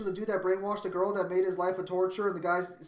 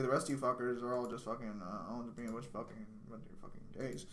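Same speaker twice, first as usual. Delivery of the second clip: fading mock insult; 8 semitones lower, 12 dB quieter than usual. Real, not acted. Trash-talk trailing off to mumble.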